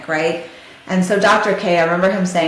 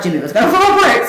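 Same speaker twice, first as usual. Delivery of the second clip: fast, a bit louder, with a real laugh mid-line; nervous laugh; 5 semitones higher, 5 dB louder, much faster than usual. Real, not acted. Telling listeners Jimmy would fall apart, laughing nervously.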